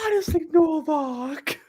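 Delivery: high, slow drawn-out character quote. Acted.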